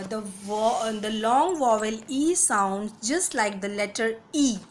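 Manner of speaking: slow textbook reading. Acted. Reads English rule slowly.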